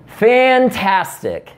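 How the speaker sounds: slow, high, drawn-out "Fantastic" meaning leave me alone; a fake-bright brush-off; dismissive fantastic. Shown as a demonstration. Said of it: "a perfect way of just saying, "Leave me alone".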